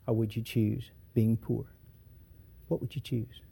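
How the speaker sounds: quiet, intimate pleading; hushed appeal. Acted. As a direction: draw the listeners in close. Preacher drops to intimate questioning.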